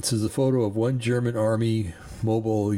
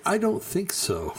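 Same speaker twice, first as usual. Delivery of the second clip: high, slowed sarcastic dismissal; sarcastic scoff; 9 semitones higher than usual. Real, not acted. Host rejecting Zelensky's comparison, high slow sarcastic scoff. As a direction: lift it way up and draw it out, scoffing.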